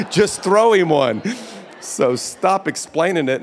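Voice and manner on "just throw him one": louder, slower, laughing through it; laughing emphatic repeat. Real, not acted. Preacher laughing at his own point to the church, loud and slow. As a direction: push it out again with a laugh.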